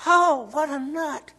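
very high, slow, loud scoffing; mock scoffer. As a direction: sneer like a gawking bystander. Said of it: "And I say".

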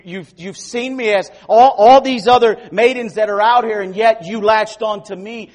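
high, loud pleading voice; pleading Ruth. Acted. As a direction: beg earnestly as Ruth. Preacher voicing Ruth to Boaz.

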